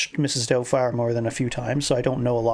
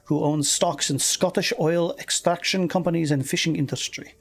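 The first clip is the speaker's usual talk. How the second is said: lilting, higher-pitched Scottish reading; Scottish accent; 3 semitones higher than usual. Acted. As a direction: read the article as a Scottish woman. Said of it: "I've been doing the Scottish accent".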